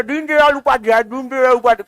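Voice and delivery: garbled name through a mouthful; chewing tobacco. Retold or imitated. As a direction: struggle to say it with gutka in mouth. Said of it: "अभी गुटखा लेकर बोलता है". (मुंह में गुटखा, अटकते हुए नाम)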